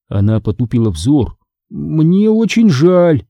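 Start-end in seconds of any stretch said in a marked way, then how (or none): 1.7-3.3 slow, hesitant condolence; sheepish drawn-out sympathy; mumbling apology. A narrator's reading. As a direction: draw the words out awkwardly, pitch raised. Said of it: "промямлил я".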